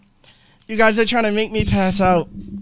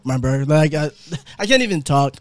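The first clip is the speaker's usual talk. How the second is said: ordinary talk, nothing marked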